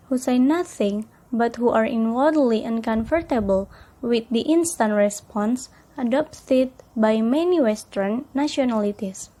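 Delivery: ordinary delivery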